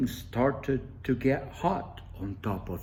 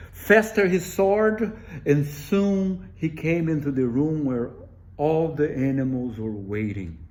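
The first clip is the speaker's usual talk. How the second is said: high, loud rising narration; excited climax; 3 semitones higher, 6 dB louder than usual. A narrator's reading. Narrator peaking as Buzzard bursts into the room. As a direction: push it up to the peak of the flight.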